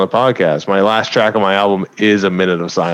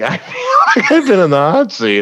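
laughing; 8 semitones higher than usual. Real, not acted. Joking under friendly questioning.

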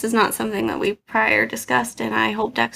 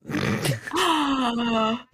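shocked gasp; 4 dB quieter than usual. Real, not acted. Reacting to shocking word.

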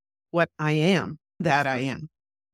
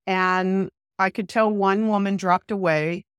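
normal talking voice

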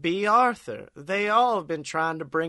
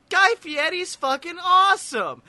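excited high shout; 11 semitones higher, 5 dB louder than usual. Acted.

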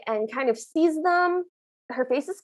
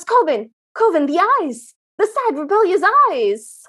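mock-urgent call, louder and higher; hammed-up cry to a friend, raised voice; dramatic calling out; 4 semitones higher, 5 dB louder than usual. Host playing the prince calling to Coven, hammed-up urgency.

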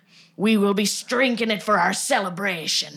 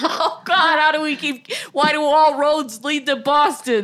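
laughing; 7 semitones higher, 4 dB louder than usual. Real, not acted. Laughing exclamation.

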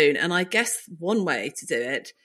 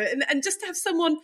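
ordinary talk

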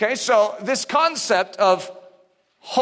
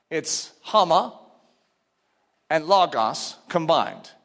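ordinary talk, nothing marked

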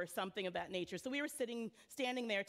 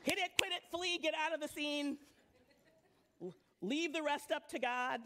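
mock hit and run; 4 semitones higher than usual. Mocking hit and run evangelism.

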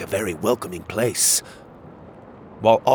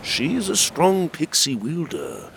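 voice in the mind; 7 semitones higher than usual. A narrator's reading. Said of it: "Gabriel said in my mind".

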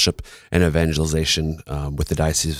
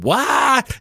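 much higher, shocked What; surprised. Pretend. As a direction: squeak it in disbelief. Imagined listener's shocked reaction.

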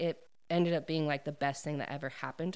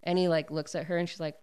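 ordinary delivery

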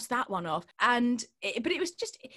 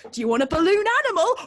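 high, loud, rapid party-host call; children's entertainer; 9 semitones higher, 9 dB louder, much faster than usual. Pretend. Mock children's entertainer offering a balloon, hammed up. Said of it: "being a children's entertainer".

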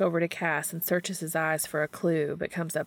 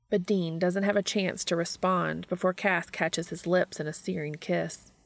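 ordinary delivery

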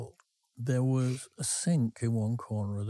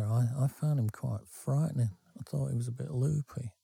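usual speaking voice

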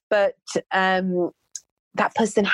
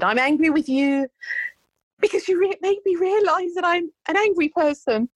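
a lightly voiced upset client, pitched much higher; imitating the angry client; 12 semitones higher than usual. Voicing the angry client's outburst.

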